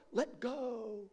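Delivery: high, hushed, fading echo; mysterious distant voice. Retold or imitated. God telling man on cliff, high soft fading call. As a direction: imitate a soft voice from afar.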